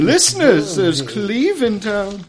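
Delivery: high, drawn-out ghostly wail; mock-eerie high croon; spooky voice. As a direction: play a spooky host, pitched high and slow. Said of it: "my spooky voice".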